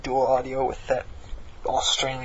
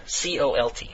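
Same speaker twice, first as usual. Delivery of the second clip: ordinary delivery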